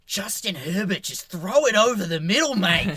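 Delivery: hammed-up Kiwi commentator urging the throw, rising to a shout; excited New Zealand accent cheering on Justin Herbert; Danny Morrison New Zealand accent. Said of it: "Such a good accent".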